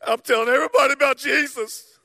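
high, loud zealous vow; mock over-eager testimony; fired-up teen. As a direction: proclaim it like a fresh convert at camp. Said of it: "they begin to say".

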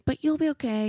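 high-pitched, sing-song comforting; mock reassurance. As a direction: imitate a neighbour's chirpy reassurance. Neighbour reassures her, retold.

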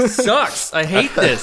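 pained, laughing through spice burn; gasping complaint, tongue on fire; mouth burning from spicy food. Real, not acted. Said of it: "You're pacing back and forth".

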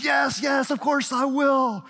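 tearful excited yes. Acted. Young woman accepting her boyfriend's proposal, acted tearful excitement. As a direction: draw out the yes as if happily overcome. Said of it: "cries and says".

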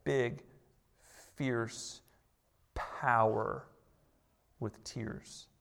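preacher landing his point, soft and slow; hushed emphasis. Acted. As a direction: hold them close with a near-hush. Sermon's quiet climactic point.

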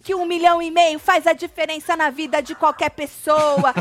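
loud re-enacted rant, slowing into drawn-out endings; imitated angry shouting. Morango tells Chay off, mock yelling. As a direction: scold loudly, stretching the last words. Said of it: "gritando com o Chay".

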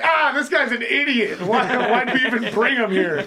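put-on lightly accented gruff mutter, laughing; a hint of thick-accented disdain through laughter; mock Scottish grumble. Friends mocked in a pub, a hint of scorn. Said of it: "And they're like".

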